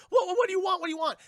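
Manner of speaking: high, loud, stammered questions; panicked pleading. Retold or imitated. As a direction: blurt it as an alarmed hostage. Hostages answer gunmen.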